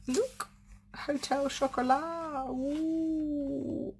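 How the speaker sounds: a high, slow squeal of delight at the chocolate; delighted gift reveal. Real, not acted. Crafter opening teammate's gift to viewers, thrilled high squeal.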